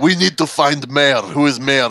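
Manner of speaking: hammed-up Russian interrogation; bad Russian accent. Russian mafia player hunting the Mayor, badly faked Russian accent. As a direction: put on a mock Russian accent. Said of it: "very bad attempt at a Russian accent".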